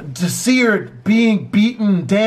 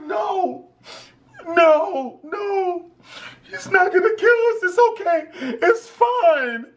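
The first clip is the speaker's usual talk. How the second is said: put-on pleading girl; 10 semitones higher, slower than usual. Girl begging through tears.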